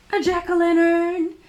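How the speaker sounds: high, loud triumphant answer; excited reveal. Acted. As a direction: proudly shout out the answer. Answering own riddle to children.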